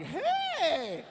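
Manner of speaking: high, drawn-out mock-excited hello; mimicked greeting. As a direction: echo the girl's squeal, stretched out. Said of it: "And then I was like".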